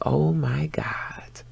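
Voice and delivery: slow, breathless whisper; awed whisper. Retold or imitated. Woman moved by her man's embrace, slow awed whisper. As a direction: whisper it slowly, overwhelmed. Overwhelmed by his hug.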